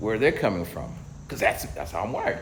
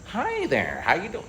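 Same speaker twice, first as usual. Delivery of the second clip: bright, higher canned phone greeting; chipper recorded greeting; 9 semitones higher than usual. Retold or imitated. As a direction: sound like a cheerful automated message. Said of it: "the recording starts".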